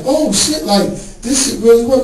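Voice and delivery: high, excited disbelief; amazed. Retold or imitated. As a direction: gasp it out, thrilled it worked. Amazed at the result.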